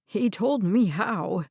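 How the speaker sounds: put-on slow, lightly trembling old man's voice; quivering voice. Red confesses to Harper that he gave himself away, quivering slowly. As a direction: let the words waver a little, slowly. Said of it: "Red's voice quivered".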